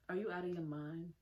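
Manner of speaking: ordinary talk, nothing marked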